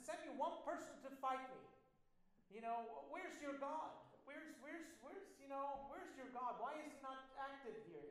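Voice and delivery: a preacher playing Goliath, taunting; taunting giant. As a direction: jeer at the whole army like a giant bully.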